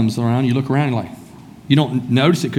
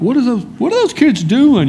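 higher-pitched mock bewilderment; puzzled onlooker; 9 semitones higher than usual. Onlooker wondering about the kids at the football game, puzzled. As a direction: voice a baffled spectator, pitched up.

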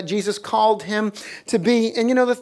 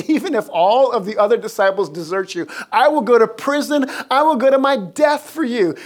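louder, higher mock-solemn vow; Peter; 4 semitones higher, 5 dB louder than usual. Disciple swearing loyalty to his master, loud and fervent. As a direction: swear it with hammed-up fervor. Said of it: "And then he swears".

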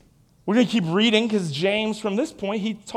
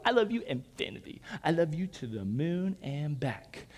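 sappy lover; 5 semitones lower, 9 dB quieter than usual. Retold or imitated. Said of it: "this disgusting and yet beautiful picture".

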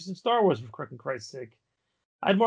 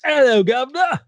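a much higher, louder mock British greeting; British accent; 10 semitones higher, 6 dB louder than usual. Friend chimes in with a mock British greeting, high and loud. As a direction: chirp it like a cartoon Englishman. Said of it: "let me do it in a British accent".

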